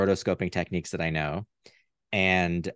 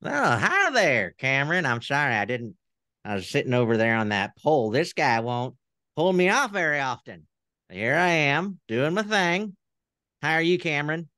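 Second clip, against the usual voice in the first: much higher folksy puppet voice greeting the host; Southern puppet character; 6 semitones higher than usual. Pretend. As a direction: play the friendly talk-show puppet. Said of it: "I'll try him on".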